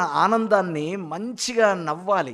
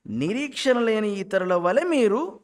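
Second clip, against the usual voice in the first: slow, raised reading halting after "మీరు"; misplaced pause; 6 semitones higher, 3 dB louder, slower than usual. Shown as a demonstration. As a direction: lift "మీరు" and stop there, leaving the verse hanging. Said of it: "ఇక్కడ pause ఇవ్వకూడదు". ("మీరు" దగ్గర స్వరం పెంచి ఆగడం)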